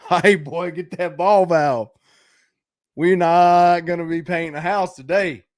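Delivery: retold jobsite yell; mock calling out. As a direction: call out urgently to a helper. Retold urgent call on job.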